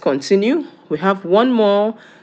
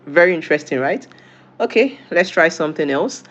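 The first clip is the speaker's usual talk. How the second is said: normal talking voice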